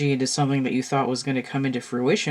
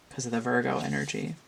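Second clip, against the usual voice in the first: usual speaking voice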